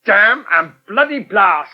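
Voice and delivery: slow, cursing out in pain; angry curse. Acted. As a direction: swear it out, drawn out and hurt. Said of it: "Did you call".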